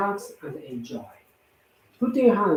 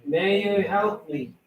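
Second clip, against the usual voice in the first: prayer; 5 semitones higher than usual. Acted. Recites a prayer aloud.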